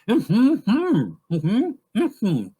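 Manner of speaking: loud, high wordless hum with lips sealed; mouth-closed talking. Shown as a demonstration. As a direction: talk in hums through a shut mouth. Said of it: "It's not hard".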